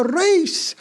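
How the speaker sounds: high, drawn-out call; excited cry. A narrator's reading. Hare demanding a race from the others, high excited cry. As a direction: send the word up high. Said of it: "cried the hare".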